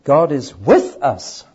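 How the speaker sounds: slow, with with leaned on; God is with us, pressing on with; slow and loud, weight on with; stress on WITH. Shown as a demonstration. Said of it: "Meaning number three".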